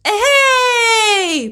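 high, long gleeful yell; a long whoop in mid-leap; shouting. A narrator's reading. Mama Mu leaps off the jetty, yelling high. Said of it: "прокричала мама Му".

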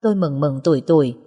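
plain reading voice

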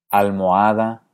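ALMOHADA with O and A apart, four syllables; hiatus. Shown as a demonstration. Teacher asks which you say, almohada in hiatus. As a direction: say almohada fully, mo-a separate. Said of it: "Tiendes a mantenerlas separadas".

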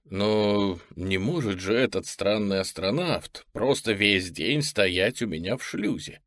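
usual speaking voice

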